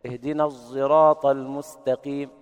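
slow chant with ص shaded into a ز sound; الصراط said zeraat-like, between ص and ز; sad blended with zay. Shown as a demonstration. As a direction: recite الصراط with ص voiced toward ز. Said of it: "هكذا".

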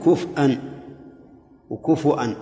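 كفءا with a still fa and hamza, then كفؤا with a damma and hamza, said slowly; alternate readings. Shown as a demonstration. Teacher listing the readings for students, slow and distinct. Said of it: "ثلاث قراءات".

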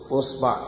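أصبع with a u on the hamza, a on the ba; variant pronunciation usba'. Shown as a demonstration. Teacher shows students the variants, أصبع said "usba'". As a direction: say أصبع as "usba'", u first, a after the b. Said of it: "أي وجه تقرأه ما تغلط".

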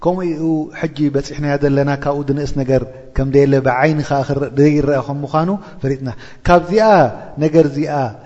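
ordinary talk

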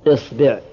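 إصبع as "isbi'", i after hamza and ba; kasra on both. Shown as a demonstration. Teacher completing the kasra set, إصبع as "isbi'". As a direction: say إصبع with two i vowels, isbi'. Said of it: "كسر الهمزة".

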